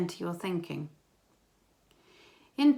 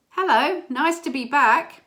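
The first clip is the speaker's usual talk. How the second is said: loud, high, sing-out greeting; raised, projected retold greeting; dad calling back; 7 semitones higher, 9 dB louder than usual. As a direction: call it up the stairs to the boys.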